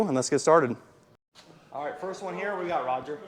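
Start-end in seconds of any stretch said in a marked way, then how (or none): 1.7-3.2 distant, off-mic voice; speaking away from the microphone; mic handed over. Real, not acted. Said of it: "I'm gonna pass the microphone over to you".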